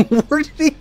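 high, squealed question through laughter; incredulous, laughing high-pitched questions; laughing disbelief. Real, not acted. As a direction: ask it cracking up, voice shooting high. Baffled, laughing at vanished driver.

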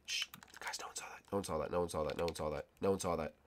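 very soft, low, repeated murmur; hushed cover-up. Pretend. Gamer hushing viewers after he fell, hushed murmur. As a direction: keep it secret, barely voiced.

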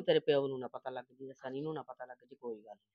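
low, soft, conspiratorial voice; secretive hush. Acted. Mother hushing daughter so father won't hear. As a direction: keep it secret from her father.

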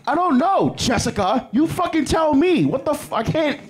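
high, hammed-up exasperated yell; mock rant at the questioner, voice shooting high; mock outburst. Snapping at nosy questioner.

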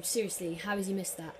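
usual speaking voice

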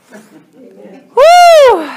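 high, loud "woo!"; sudden high whoop; excited whoop. Real, not acted. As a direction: let out a big high whoop. Playful cheer over wanting faster growth.

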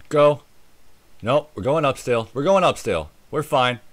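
usual speaking voice

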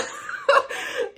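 high airy laugh; breathy laugh. Real, not acted. Laughing at herself about her age.

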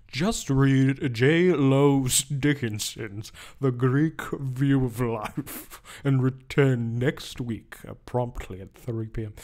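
slow, drawn-out, lofty professor voice; pompous old professor. Retold or imitated. A professor dismisses a student at office hours, slow and lofty. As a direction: stretch every word like a stuffy don. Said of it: "Be like".

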